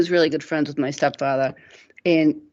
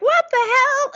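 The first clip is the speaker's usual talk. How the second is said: very high, louder startled cry; shocked stepfather; 4 dB louder than usual. Retold or imitated. Stepfather sees the forehead, high mock shriek. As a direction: squeal out the shock. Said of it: "he started like".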